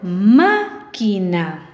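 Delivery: "Máquina" very slowly in syllables, MÁ held long; stress on first syllable. Shown as a demonstration. Teacher repeats the word for students, "Máquina" drawn out with MÁ stressed. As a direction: stretch MÁ, then set down "quina" carefully. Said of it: "En la antepenúltima sílaba".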